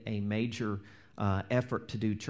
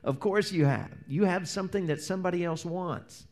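ordinary delivery

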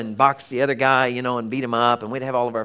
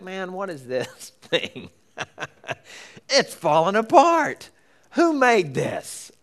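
higher, slow exasperated grumble; frustrated car owner; 5 semitones higher, slower than usual. Retold or imitated. Driver fed up with an old car, higher and exasperated. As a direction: fume at a car falling apart. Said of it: "you're like".

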